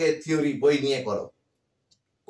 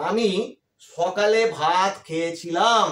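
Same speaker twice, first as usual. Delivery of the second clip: slow demo; slower than usual. Shown as a demonstration.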